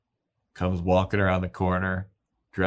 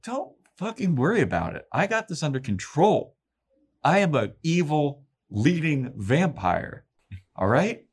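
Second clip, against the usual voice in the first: cocky vampire Willow; 7 semitones higher than usual. Retold or imitated.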